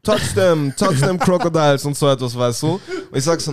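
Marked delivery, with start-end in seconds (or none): 0.0-1.8 louder laid-back Jamaican voice; Rasta impression. Speaker imitating the Rasta guide urging him, relaxed Jamaican accent. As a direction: push the crocodiles in a chill Jamaican drawl. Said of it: "und er sagt".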